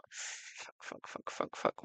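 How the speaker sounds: whispered swearing under the breath; muttered curse. Real, not acted. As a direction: swear quietly to yourself, not for the viewers. Losing a comment mid-stream.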